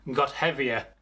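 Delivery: ordinary delivery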